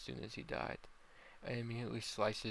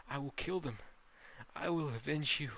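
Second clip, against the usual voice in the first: higher, forceful vow; raised, determined voice; vengeful vow; 5 semitones higher, 3 dB louder than usual. Acted. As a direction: swear revenge on the killers. Son vows revenge to father.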